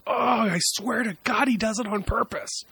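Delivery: high, slowed mock-mom wail; mimicking his mom's high exasperated voice. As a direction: whine it like a fed-up mother. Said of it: "And it's like".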